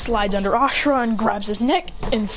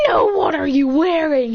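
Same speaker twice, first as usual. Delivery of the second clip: higher, drawn-out question; incredulous; 4 semitones higher, 4 dB louder than usual. Acted. Character questioning another's outfit, drawn-out incredulity. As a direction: ask it slowly with raised pitch. Incredulous reply about clothing.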